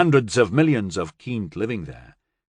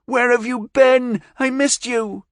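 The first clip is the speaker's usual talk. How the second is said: hurt; 13 semitones higher, 4 dB louder than usual. Acted. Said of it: "plenty of hurt in the voice".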